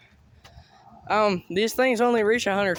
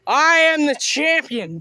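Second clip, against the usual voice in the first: slow, louder victory boast; gloating; 4 semitones higher, 5 dB louder, slower than usual. Real, not acted. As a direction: proclaim yourself the winner. Victory after Nerf battle.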